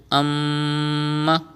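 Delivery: amma hummed through the nose on the doubled m; with gunna. Shown as a demonstration. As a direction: nasalize the mm of amma and hold it one beat. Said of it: "read it with gunna like this".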